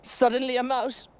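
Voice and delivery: high, loud, rushing storyteller; mounting alarm. A narrator's reading. Winnie telling the mouse story, high and alarmed. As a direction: build the fright as the mouse runs.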